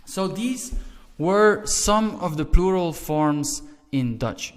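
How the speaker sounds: ordinary talk, nothing marked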